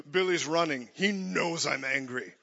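hammed-up god chuckling through the words; lightly voiced Zeus enjoying the chase; gloating Zeus. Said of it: "And then he's like".

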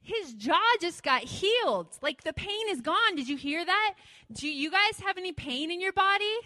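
loud, high call down the street; yelling out. Retold or imitated. Joaquin calling to the healed man's friends, loud shout. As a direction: call out to the friends so they hear. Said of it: "Joaquin yells out to him".

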